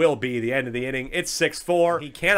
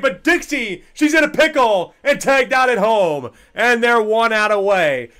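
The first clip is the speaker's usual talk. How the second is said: excited call; 7 semitones higher, 6 dB louder than usual. Acted.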